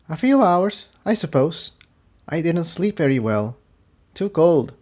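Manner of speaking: high, soft tired filly; small cold pony voice; Tiptoe voice. Acted.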